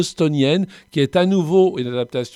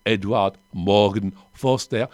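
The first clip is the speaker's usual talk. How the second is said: put-on English accent; 4 semitones lower, slower than usual. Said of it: "On va arrêter avec mon accent anglais".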